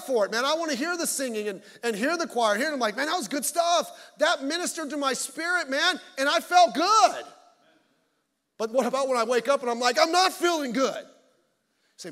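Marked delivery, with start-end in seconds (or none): 3.0-7.2 loud, high, fired-up praise; elated churchgoer. Acted. Acting out elated worship reaction. 10.0-11.1 mock morning complaint. Voicing his morning reluctance.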